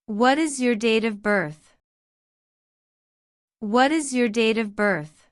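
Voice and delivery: usual speaking voice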